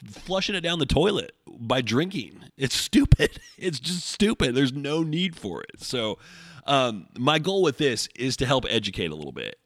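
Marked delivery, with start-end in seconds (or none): 0.1-5.8 exasperated, higher-pitched rant; fed-up, raised-pitch venting; pissed off. Real, not acted. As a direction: vent real frustration at clients. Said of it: "I get a little fucking pissed".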